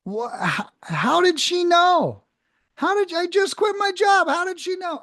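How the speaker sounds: as if she just read your mind; stunned disbelief. Retold or imitated. Retelling the caller who guessed he quit, in stunned disbelief.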